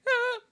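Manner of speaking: short high, loud imitated scream; mock panicked cry; freak-out yelp. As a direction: yelp like a startled grandma. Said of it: "She kinda freaks it sometimes".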